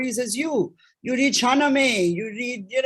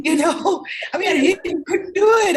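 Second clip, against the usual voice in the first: high, loud, helpless laughter through the words; laughing confession; 9 semitones higher, 5 dB louder than usual. Real, not acted. Owning up to an unproductive lockdown, laughing. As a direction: confess it while cracking up.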